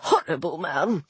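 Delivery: low, disdainful remark; understated huffy disapproval; indignant sniff. A narrator's reading. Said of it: "Disturber sniffed indignantly".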